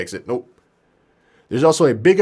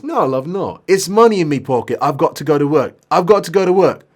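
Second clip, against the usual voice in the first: raised British patient's protest; British accent; 5 semitones higher, 5 dB louder than usual. Acted. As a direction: insist to the nurse in British accent. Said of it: "maybe he is British".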